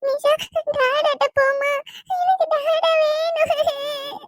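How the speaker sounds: very high, drawn-out sobbing plea; tearful begging, stretched into a wail; crying. Acted. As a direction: wail it slowly like an inconsolable child. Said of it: "அழக்கூடாதுடா".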